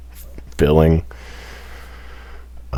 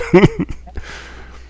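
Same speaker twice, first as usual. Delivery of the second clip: high wheezing laugh; breathy laugh. Real, not acted. Laughing at the campfire bit.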